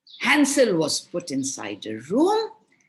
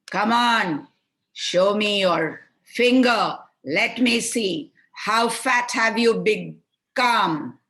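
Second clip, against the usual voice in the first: higher, louder, slow witch voice; higher, louder witch voice demanding to see Hansel's finger; 5 semitones higher, 7 dB louder than usual. Acted. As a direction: demand it like an impatient hag.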